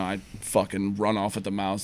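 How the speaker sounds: ordinary talk, nothing marked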